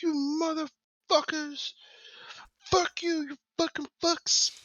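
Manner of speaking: high, strained captive's rant; hammed-up furious falsetto yell; mock screaming. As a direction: scream curses without full volume. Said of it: "he's just screaming".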